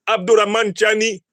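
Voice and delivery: ordinary talk, nothing marked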